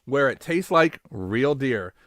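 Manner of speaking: ordinary talk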